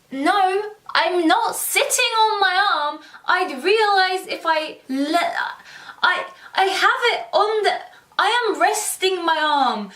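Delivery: hammed-up indignant retort; indignant protest. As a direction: protest to mum, exasperated and insistent. Acted argument with doubting mum.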